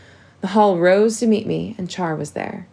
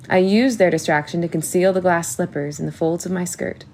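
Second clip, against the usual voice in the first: ordinary delivery, nothing marked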